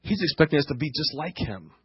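the disciples groan under their breath at the task; muttered dismay. Retold or imitated. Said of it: "And they go".

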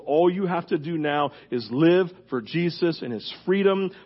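ordinary talk

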